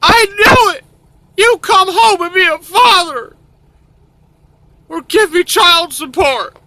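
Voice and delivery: loud falsetto mock woman; shrieking mother. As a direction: screech the demand in a high voice. Voicing the mother demanding support.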